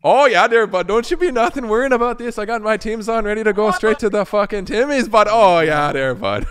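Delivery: a cheery over-the-top Canuck; mock Canadian. Joins the mock Canadian bit.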